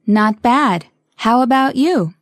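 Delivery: slow and over enunciating; each word spelled out carefully; slowly. Shown as a demonstration. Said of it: "one more time slowly".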